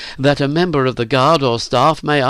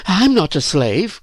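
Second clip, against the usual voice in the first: put-on high, slow girl's protest; indignant cry; 8 semitones higher than usual. A narrator's reading. Phyllis denies Virginia's retort, crying out. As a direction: cry it out in denial. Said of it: "cried Phyllis".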